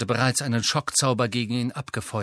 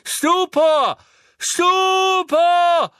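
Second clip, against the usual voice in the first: much higher, louder shouted spell; Neville casting spell; 8 dB louder, much slower than usual. A narrator's reading. Neville attacking Death Eaters, shouted spell. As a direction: fling the spell with all he has. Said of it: "rief Neville erneut".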